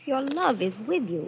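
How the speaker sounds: ordinary talk, nothing marked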